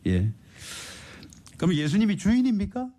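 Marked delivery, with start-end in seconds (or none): 1.6-2.9 high, ringing preacher's challenge; fervent preaching. Acted. Preacher challenging the congregation, ringing high cry. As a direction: call it out to the whole church.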